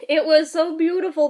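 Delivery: ordinary talk, nothing marked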